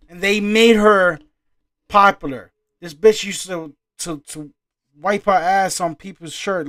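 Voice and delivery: loud, indignant outburst; worked-up, high-volume complaint; heated rant. Real, not acted. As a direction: shout it, hammering out the words.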